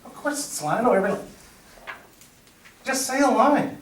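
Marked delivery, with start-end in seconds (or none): 2.8-3.8 higher, pressing urge; urgent prompt. Retold or imitated. Onstage to a frozen castmate, an urgent higher prompt. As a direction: push him to just speak.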